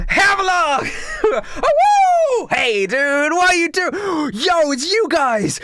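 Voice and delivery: high, loud party-host greeting; exuberant Blake. Acted. Blake welcomes his friends to a drink, exuberant. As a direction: whoop and holler a welcome to old friends. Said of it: "but immediately Blake goes".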